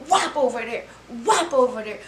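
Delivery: dramatic whop imitation; mock whop sound. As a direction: act out each throw. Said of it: "I'm dramatic".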